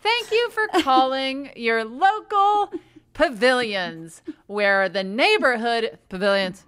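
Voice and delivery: loud recorded store greeting, starting very high; upbeat hold message. Acted. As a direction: blare out an over-cheerful hold announcement.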